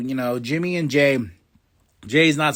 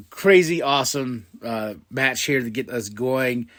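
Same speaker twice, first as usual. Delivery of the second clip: normal talking voice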